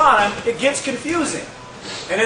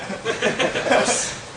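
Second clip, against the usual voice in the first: soft laugh; 2 semitones higher, 5 dB quieter than usual. Real, not acted. Amused at own belief.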